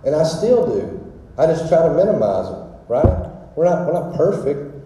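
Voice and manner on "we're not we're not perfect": usual speaking voice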